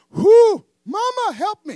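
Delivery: hammed-up high, loud cry for mama; panicked shriek. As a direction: squeal for help in mock terror. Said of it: "In my natural self".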